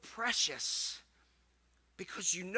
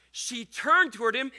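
ordinary talk

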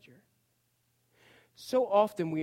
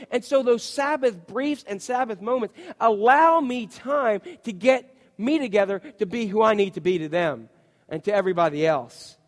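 ordinary delivery

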